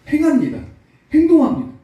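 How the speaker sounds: fervent preaching. Real, not acted. Preacher exhorting congregation, loud high climax. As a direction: drive the point home, rising.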